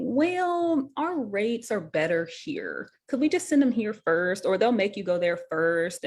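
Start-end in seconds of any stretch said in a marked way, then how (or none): 0.0-4.5 high, drawn-out mock "well"; hedging company voice, rising to a question; insurance company rep. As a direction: stall slowly like a penny-pinching insurer. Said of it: "the insurance company is saying".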